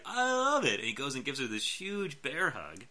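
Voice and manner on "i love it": much higher, thrilled squeal of joy; gushing delight. Retold or imitated. Will gushes over woman's jacket, high delighted squeal. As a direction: gush it like you just fell in love. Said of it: "And he was like".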